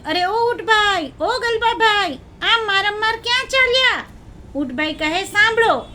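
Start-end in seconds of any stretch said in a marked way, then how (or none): 0.0-4.0 calling out. Acted. Elephant hails the camel and jackal, calling out. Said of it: "મકના હાથીએ બૂમ પાડી". 5.2-5.9 high, loud call to listen; announcing. Acted. Camel tells the elephant to listen, announcing.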